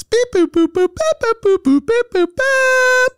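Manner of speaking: very high, loud beeping; robot beeps. Pretend. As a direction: chirp it out high like a computer. Segue sound effect.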